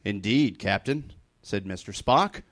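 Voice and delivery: ordinary talk, nothing marked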